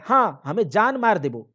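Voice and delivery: loud, high threat; husband shouting a threat loudly; angry threat. Acted. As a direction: bark the threat at her.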